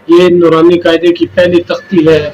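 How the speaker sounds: ordinary talk, nothing marked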